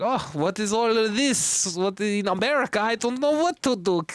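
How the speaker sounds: high, put-on French accent. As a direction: play a helpless newcomer with a high French accent. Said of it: "put on a French accent".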